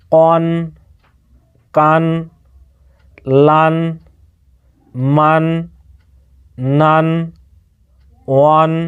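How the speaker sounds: আন, বান, তান as single held syllables on a level note; pronunciation drill. Shown as a demonstration. Teacher showing learners the pronunciation of আন to ইয়ান, one steady syllable each. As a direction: sound out each syllable like আন, বান, drawn out and evenly pitched, with long pauses. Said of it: "এবারে উচ্চারণটা আপনারা দেখেন".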